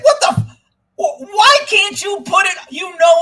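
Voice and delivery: very high, loud outburst; furious, high-pitched venting; exasperated shouting. Real, not acted. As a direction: shout in sheer disbelief at them. Rants back at commenters.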